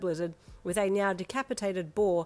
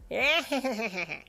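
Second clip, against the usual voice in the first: eager laugh; 3 semitones higher than usual. A narrator's reading. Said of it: "He laughed".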